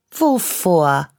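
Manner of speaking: put-on girl grinning back at the boy, teasing; grinning retort. A narrator's reading. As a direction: toss it back with a grin, fearless now. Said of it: "grinste ich zurück".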